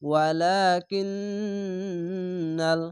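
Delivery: ওয়ালাকিন্নাল with the ন্ন held long and humming; nasal ghunna. Shown as a demonstration. Teacher showing compulsory ghunna, slow and chanted. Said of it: "এই সে ওয়াজিব গুন্না".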